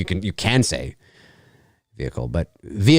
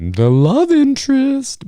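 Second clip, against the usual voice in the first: mock dramatic; 5 dB louder, slower than usual.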